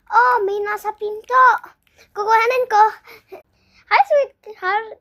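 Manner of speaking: sweet mom voice. Acted. Acted mother greeting at door.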